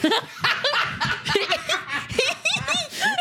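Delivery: high, squealing laugh; helpless laughter. Retold or imitated. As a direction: crack up in a high-pitched laugh. Retells trying not to laugh.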